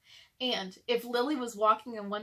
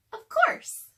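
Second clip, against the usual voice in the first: much higher, louder cat voice; Lily's voice; 8 dB louder than usual. Acted. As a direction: squeak it out as the fierce little cat. The cat Lily speaks, disguised.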